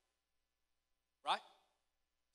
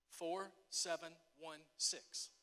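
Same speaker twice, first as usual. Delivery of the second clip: slow deliberate; 3 semitones lower, 4 dB quieter, slower than usual. Real, not acted. Slowly announcing numbers seen in vision.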